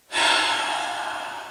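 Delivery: a slow breathy sigh let out unheard; long quiet exhale. Retold or imitated. Exhaling quietly during a phone interview, soft sigh. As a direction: exhale softly so she can't hear. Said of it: "just exhaled".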